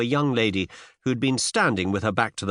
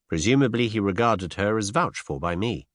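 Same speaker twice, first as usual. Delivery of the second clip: plain reading voice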